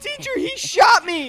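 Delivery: high, loud, whiny tattle; tattling shout. Acted. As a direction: yell for the teacher. Child tattling to teacher.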